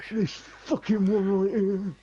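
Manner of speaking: high, drawn-out dramatic loathing; exaggerated disgust. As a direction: ham up the hatred, stretching it slowly. Mock dread at the hated update.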